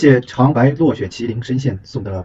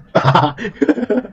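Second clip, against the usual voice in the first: high giggle; giggling laugh; 9 semitones higher than usual. Real, not acted. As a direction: giggle at the chaos. Laughs during game chaos.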